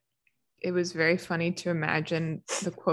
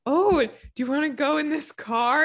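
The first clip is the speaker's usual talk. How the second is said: parent voice; 8 semitones higher, 4 dB louder than usual. Retold or imitated. Parents coaxing kid, retold.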